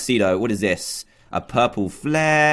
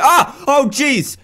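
startled yelp; 11 semitones higher, 5 dB louder, much slower than usual. Real, not acted. Startled by a zombie.

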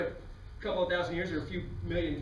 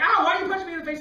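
high, loud startled protest; indignant yelp; 13 semitones higher, 10 dB louder, faster than usual. Acted. Punched strangers protest to God, loud high indignant yelp. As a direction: cry out as if just punched.

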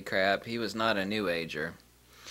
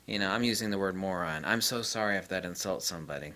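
ordinary talk, nothing marked

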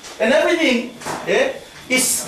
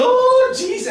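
high mock cry of a spirit-filled lady; lightly hammed-up ecstatic praying woman; 12 semitones higher, slower than usual. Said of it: "They go".

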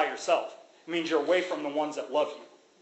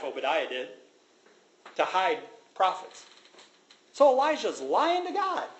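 ordinary talk

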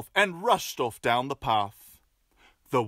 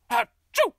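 hammed-up sneeze; fake sneeze; 8 semitones higher, 3 dB louder than usual. Wolf faking a cold, sneezing. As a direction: ham up a big sneeze. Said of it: "adding a sneeze".

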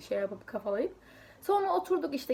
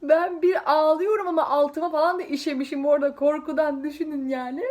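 laughing; 4 semitones higher, 6 dB louder than usual. Real, not acted. Laugh-crying over memory.

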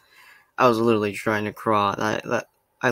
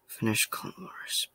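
trailing into a slow whisper; 8 dB quieter than usual. Real, not acted. Fan who finally finished Clone Wars, whispering. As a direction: let it drop to a hushed whisper. Savouring the end of series.

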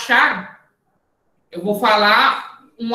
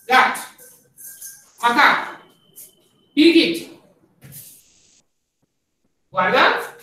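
called drill commands; 3 semitones higher than usual. Acted. Coach calling footwork cues to the fencing class. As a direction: bark each animal name as a cue to move.

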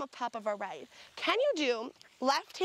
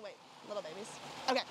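ordinary talk

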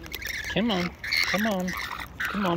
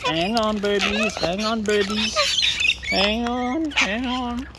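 high, sing-song voice for the birds; pet talk; 6 semitones higher, 4 dB louder, much slower than usual. Real, not acted.

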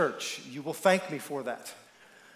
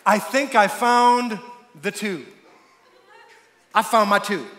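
put-on excited young man; 3 semitones higher, 6 dB louder than usual. Young man announcing his find to everyone, acted elation. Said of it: "a young godly man comes running in going".

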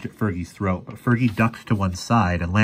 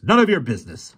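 higher, curt snap; snapping; 7 semitones higher, 4 dB louder than usual. A narrator's reading. Fergie answers the armed boy, snapping. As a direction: snap back at the challenge. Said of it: "snapped Fergie".

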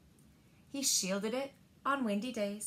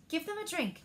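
lightly raised, bright instruction; Nana's cheerful voice; 7 semitones higher than usual. A narrator's reading. Grandmother telling the child to water the seeds, lightly raised. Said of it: "Nana says".

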